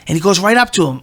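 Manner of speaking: normal talking voice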